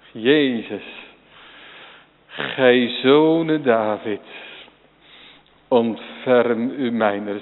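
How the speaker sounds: slow, earnest prayer; slow plea. Acted. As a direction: plead each word slowly. Voicing Bartimaeus' prayer.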